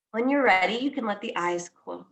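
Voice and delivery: guided meditation. Acted. Teacher guiding listeners into noticing their breath, calm guided meditation. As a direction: ease each phrase out and let it settle.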